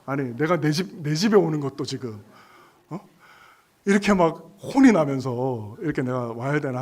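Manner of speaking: loud, indignant mock protest; hammed-up aggrieved outburst; retold irritation. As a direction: act out being riled at a scolding. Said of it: "제가 빠직 해가지고".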